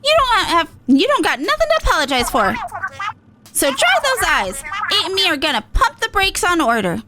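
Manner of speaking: rally the team, cocky; Pearl, fired up. Acted. Pearl promising Marina they'll stop Order, fired up.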